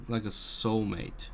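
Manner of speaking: normal talking voice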